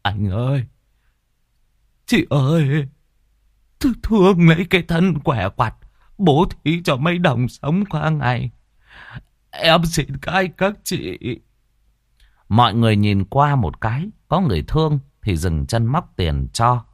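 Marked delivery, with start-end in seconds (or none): 0.0-11.2 pleading beggar. Acted. Fake cripple begs passers-by for coins, pleading. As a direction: beg passers-by for pity in a raised voice.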